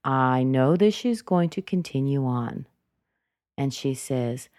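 usual speaking voice

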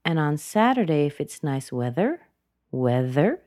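"weather" turned up at the end; unfinished upturn on "weather"; rising intonation. Shown as a demonstration. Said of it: "there's another one".

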